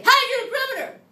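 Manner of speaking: high, loud call-out voice; weird voice. Shown as a demonstration. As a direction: shout it in a silly high voice. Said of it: "We say it in a weird voice".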